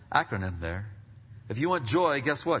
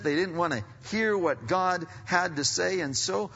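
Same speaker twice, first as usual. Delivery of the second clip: raised, loud scripture declamation; forceful preaching; 7 semitones higher, 6 dB louder than usual. Real, not acted. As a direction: build to a loud proclamation of judgment.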